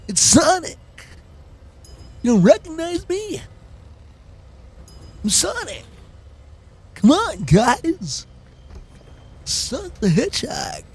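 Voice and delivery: Sonic impression. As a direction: streamer straining for a Sonic pitch. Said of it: "I do not sound like who I'm trying to sound like".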